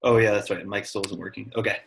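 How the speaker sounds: normal talking voice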